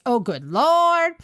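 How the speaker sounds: mock Southern exasperation. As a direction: sigh it out like a fed-up Southern principal. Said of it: "he always goes".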